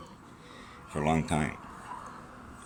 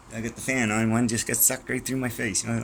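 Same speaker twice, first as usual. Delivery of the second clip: usual speaking voice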